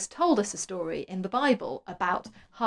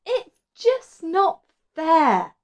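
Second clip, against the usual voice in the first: high, slow, whiny complaint; moaning Martha; 9 semitones higher, 7 dB louder, much slower than usual. A narrator's reading. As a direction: whine it like a fed-up sister. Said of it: "moaned Martha".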